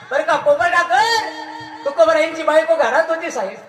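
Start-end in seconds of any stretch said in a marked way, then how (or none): none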